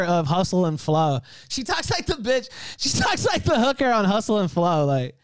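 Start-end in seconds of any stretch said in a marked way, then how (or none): none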